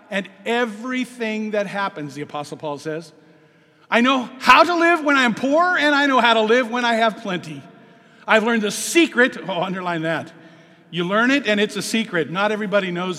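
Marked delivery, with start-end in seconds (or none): 3.9-9.4 loud, ringing sermon read; preaching at full force. Acted. Preacher proclaiming Paul's verse loudly to the church. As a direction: proclaim it to the congregation.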